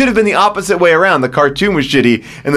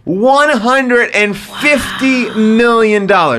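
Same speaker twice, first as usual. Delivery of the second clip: slow, emphatic, higher announcement; dramatic reveal; 4 semitones higher, much slower than usual. Real, not acted. Host reveals the movie's budget to amazed co-hosts, slow and emphatic. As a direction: draw out each word of the figure.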